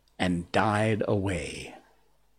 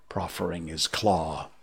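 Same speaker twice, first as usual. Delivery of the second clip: plain reading voice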